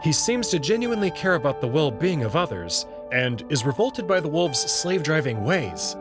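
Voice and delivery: usual speaking voice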